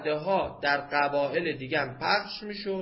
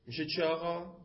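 normal talking voice

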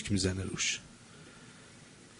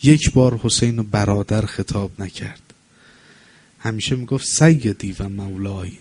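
mourning preach; 3 dB louder than usual. Acted. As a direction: draw the words out in grief for the martyrs. Mourning sermon close.

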